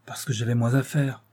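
ordinary delivery, nothing marked

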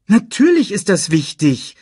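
high, loud, emphatic objection; contradicting. A narrator's reading. Nala contradicts Simba, loud and emphatic. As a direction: object firmly and urgently. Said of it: "widersprach Nala".

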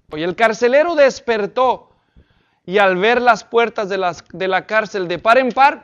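loud, emphatic preacher's reading; dramatic scripture reading. Acted. Preacher reading the jailer's story to the congregation, loud and dramatic. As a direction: proclaim the verse loudly to the room. Reads scripture aloud with force.

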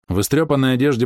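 plain reading voice

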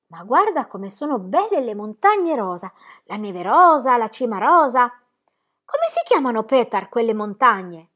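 lightly voiced child full of wonder; lightly voiced little girl. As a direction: marvel like a little girl at pink mountains. Narrator voices child Heidi.

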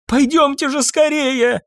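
high, drawn-out plea; anxious maid's high cry; frantic urging. A narrator's reading. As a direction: beg them in panic. Trembling maid urges them.

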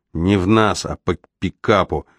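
usual speaking voice

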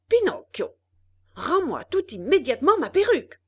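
high, loud, fast shout; angry shout. A narrator's reading. Geppetto shouting at Pinocchio for his wig, furious. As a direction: bark out the demand.

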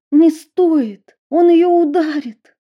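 high loud frightened plea; panicked pleading. A narrator's reading. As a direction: beg him in alarm.

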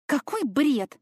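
high, sharp indignant exclamation. A narrator's reading. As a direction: scoff at the absurd idea. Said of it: "Я возмущённо тряхнула головой".

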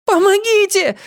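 very high scream for help; shrieked, panicked girl's cry. A narrator's reading. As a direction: scream for help, struggling to get free. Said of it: "закричала Нола".

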